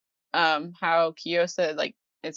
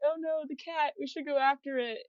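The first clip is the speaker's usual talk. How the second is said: put-on higher, softer worried girl voice; a sweet girl fretting, pitched up and hushed; Tohru's voice; 8 semitones higher, 5 dB quieter than usual. Tohru worries about the runaway cat, high soft voice. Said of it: "So Toru's like".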